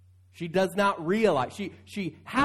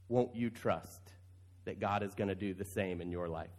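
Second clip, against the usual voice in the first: gentle appeal; 7 semitones lower, 9 dB quieter than usual. Real, not acted. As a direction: ask it softly, as a personal invitation. Pastor's quiet personal appeal.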